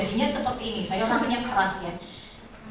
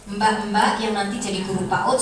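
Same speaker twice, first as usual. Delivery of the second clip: ordinary delivery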